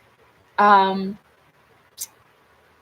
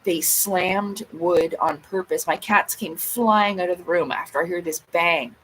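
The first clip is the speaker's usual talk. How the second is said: ordinary talk, nothing marked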